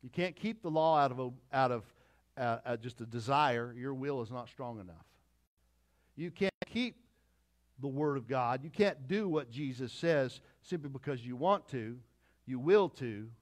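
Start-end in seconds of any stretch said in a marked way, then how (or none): none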